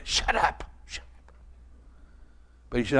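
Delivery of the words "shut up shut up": a sharp whisper, words breathed without voice. Retold or imitated. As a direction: hush the barking dog through the door. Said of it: "I heard her say".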